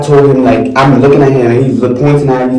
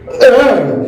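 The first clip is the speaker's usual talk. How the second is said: disgusted ugh; 12 semitones higher than usual. Retold or imitated. Disgust at the sight.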